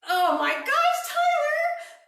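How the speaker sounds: high, loud disbelieving shout of his name; mock-outraged yelp at a wrong answer; shocked cry. Real, not acted. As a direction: call out in stunned amusement.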